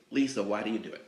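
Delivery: ordinary talk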